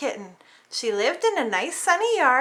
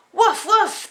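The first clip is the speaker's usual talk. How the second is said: higher, louder woof woof; dog bark; 7 semitones higher, 5 dB louder than usual. Acted. Kitten's scare from the dog, barking. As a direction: bark it like a big dog waking.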